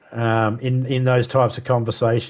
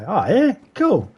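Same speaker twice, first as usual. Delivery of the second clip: mock casual reaction; 8 semitones higher than usual. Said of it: "I was like".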